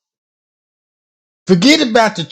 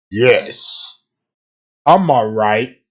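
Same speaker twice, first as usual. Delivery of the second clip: a much lower, flat robot voice; mock spirit reply; 11 semitones lower, much slower than usual. Mocking a psychic's spirit answer, low and robotic. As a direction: drop low and sound programmed.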